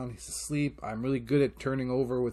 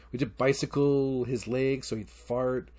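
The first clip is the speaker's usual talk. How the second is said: ordinary delivery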